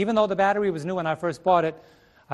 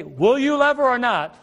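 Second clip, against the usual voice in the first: mock threat; 6 semitones higher, 5 dB louder than usual. Fire voiced threatening a groom.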